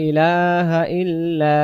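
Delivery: ইলাহা ইল্লা with the la held long; drawn out la. Shown as a demonstration. Teacher demonstrating the long লা in ইলাহা. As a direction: hold the লা of ইলাহা for one alif. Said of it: "টান দেব".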